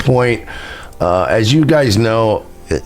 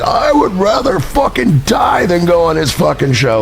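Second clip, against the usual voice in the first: higher yelling refusal; mock drunk yell; 8 semitones higher than usual. Retelling a drunk shouting match with a friend, mock yell. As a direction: shout it back, hammered and defiant. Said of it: "we were screaming at each other".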